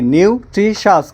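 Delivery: নিউট্রিশাস broken into three beats, নিউ-ট্রি-শাস; syllable by syllable. Shown as a demonstration. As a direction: sound out নিউট্রিশাস one syllable at a time. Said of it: "কয় syllable এখানে". (নিউট্রিশাস ভেঙে ভেঙে উচ্চারণ)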